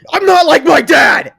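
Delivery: explosive, high, loud shout; furious outburst denying his father; yelling. Acted. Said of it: "Izuku yells".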